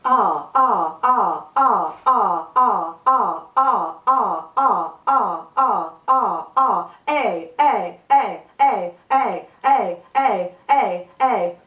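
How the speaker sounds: loud punchy R bursts from the diaphragm; short shouted vowel pulses; kicked R sounds. Shown as a demonstration. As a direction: kick each R out hard. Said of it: "do this about thirty times".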